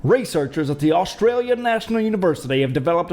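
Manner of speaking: a poor put-on Australian accent reading the headline; bad Australian accent. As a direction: try an Australian accent and miss. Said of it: "I don't even know what accent that is".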